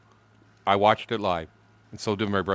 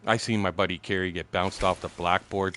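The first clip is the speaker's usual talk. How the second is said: ordinary talk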